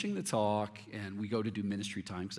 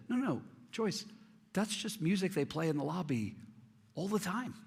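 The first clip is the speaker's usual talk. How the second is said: much higher, gently correcting voice; gentle correction; 9 semitones higher than usual. Retold or imitated. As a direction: set her straight kindly, pitch raised. Correcting friend about lobby music.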